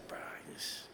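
whispered, trailing off in defeat; exasperated whisper. Retold or imitated. Pastor giving up on the other driver, whispered. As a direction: breathe it out, giving up.

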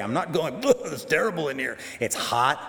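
comic, exaggerated complaint; mock disgusted refusal. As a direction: play a stubborn man grossed out by where he is. Acts out Jonah disgusted inside the fish.

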